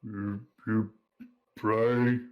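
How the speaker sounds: slow, halting big tavern regular; lightly voiced half-troll, halting over the words; hesitant half-troll. Half-troll leaning over to a newcomer, hesitant. Game master voices Hork.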